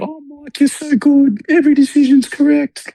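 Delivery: squeaky-high gushing suck-up voice; high-pitched mock flattery. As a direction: gush in a silly, very high put-on voice. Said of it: "not necessarily in that high-pitched voice".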